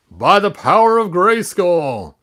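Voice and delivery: loud, high battle cry raising the sword; heroic shout. Acted. Prince raising the sword shouts the heroic oath. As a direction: call out the magic words with full force.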